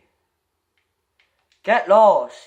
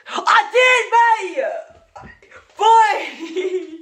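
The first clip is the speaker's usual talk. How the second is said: triumphant laughing shout; 12 semitones higher, much slower than usual. Real, not acted. Gloating at Dom over the two Torahs, laughing shout. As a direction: crow at your mate, laughing.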